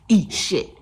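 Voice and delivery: loud, punched-out mimicked insult; blunt, loud retort in imitation; acted insult. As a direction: deliver it hard, as the rude passenger. Passenger's insult acted after beckoning.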